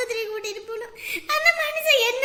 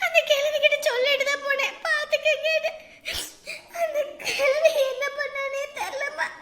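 tearful wail; 3 semitones higher than usual. Acted. Mother telling how the old woman hurt her child, crying. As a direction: wail through the tears. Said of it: "ஐயோ அண்ணி, அழாதீங்க".

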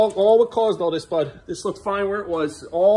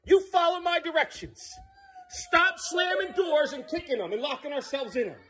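raised voice; 10 semitones higher, 5 dB quieter than usual. Real, not acted. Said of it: "Sorry I raised my voice".

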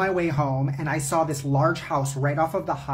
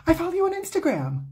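much higher, sugary mock-cheerful voice; mock the thief's phony delighted greeting; fake friendly gush; 13 semitones higher than usual. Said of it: "has the fucking audacity to look at me and go".

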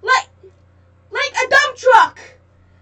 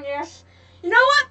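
usual speaking voice

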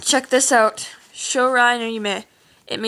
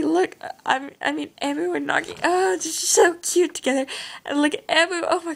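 gushing; 7 semitones higher than usual. Real, not acted. Gushing over her drawing.

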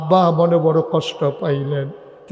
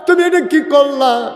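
high, loud wail of reproach; impassioned preacher acting a husband's grief; anguished lament; 12 semitones higher, 7 dB louder than usual. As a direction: cry out to a sick wife in anguish. Ali laments over feverish wife.

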